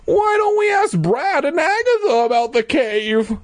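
very high, squeaky put-on kid; child hero voice. Hero asking Louisa about fun, squeaky kid voice. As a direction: pitch it way up for the young hero.

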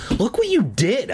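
high-pitched indignant complaint; acted upset outcry; crying out. A narrator's reading. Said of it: "I cried".

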